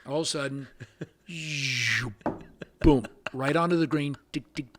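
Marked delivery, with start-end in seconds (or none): none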